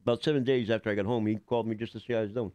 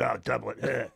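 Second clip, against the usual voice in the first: delivering his line; slower than usual. Retold or imitated. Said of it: "I just said".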